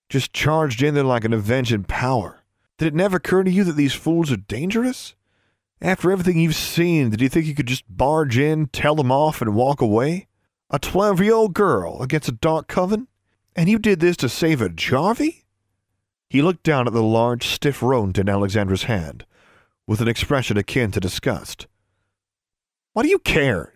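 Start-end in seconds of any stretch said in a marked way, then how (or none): 0.1-15.4 higher-pitched, rising rhetorical questions; incredulous scolding. A narrator's reading. Boy scolding her for charging in, incredulous. 22.9-23.7 defiant retort. A narrator's reading. Girl retorts to scolding.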